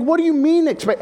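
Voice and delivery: Joseph's mock startled reaction to the news; shocked. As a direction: jump up in pitch, caught off guard.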